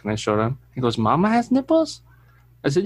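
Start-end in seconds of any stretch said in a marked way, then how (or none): 1.0-2.0 put-on high, wide-eyed kid voice; a toddler's amazed question; child's voice. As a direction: quote the little boy, higher up. Son quoted, much higher.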